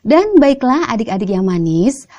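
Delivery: usual speaking voice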